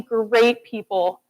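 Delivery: ordinary talk, nothing marked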